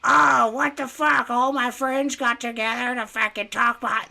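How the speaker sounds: high, loud put-on complaining voice; mock her throwing a fit; Michaela. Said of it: "that's my Michaela impression".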